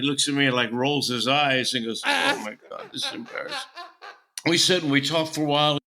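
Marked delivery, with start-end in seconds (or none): 2.1-3.6 mock embarrassment, laughing. Embarrassed aside, rolling eyes, laughing.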